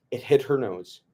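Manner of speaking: ordinary delivery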